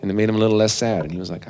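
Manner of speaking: normal talking voice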